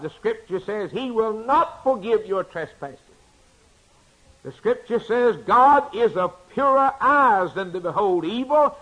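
ordinary delivery

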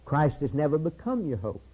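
ordinary delivery

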